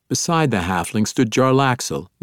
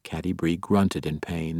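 usual speaking voice

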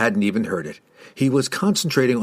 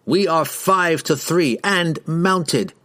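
slow, higher, deliberate threat; threatening boast; 6 semitones higher, slower than usual. A narrator's reading. Rider warns the dwarf of the odds, threatening.

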